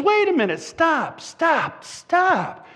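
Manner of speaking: high-pitched, repeated halting cries; urgent protest. Acted. Butcher interrupting the angry owner, urgent high protest. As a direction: cut in urgently, pitch jumped up.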